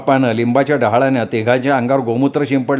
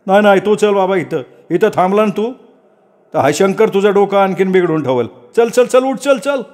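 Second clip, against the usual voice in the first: high, insistent coaxing, climbing higher; insistent villager; 7 semitones higher than usual. Acted. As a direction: hurry the boy up, getting higher. Villager pulls the boy along. (उंच आवाजात आग्रहाने घाई करणारा)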